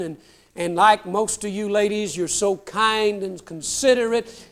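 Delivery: usual speaking voice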